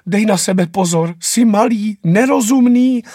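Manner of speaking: mother shouting warnings after her departing son; mother calling after. Acted. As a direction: call anxiously after a child who is leaving. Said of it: "maminka za ním volá".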